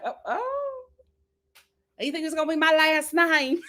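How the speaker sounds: high, breathless, starry-eyed mock swoon; swooning girl. Imitating Charity hearing about her future name, mock swoon. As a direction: play her giddy and flattered. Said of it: "Cherry would have been like".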